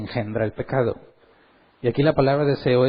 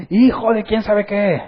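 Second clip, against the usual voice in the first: higher, louder, slow mock yell at a driver; mock insult; 8 semitones higher, 5 dB louder, slower than usual. Preacher retells a coworker cursing the driver who cut him off, mock yell. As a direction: fling the insult half in jest.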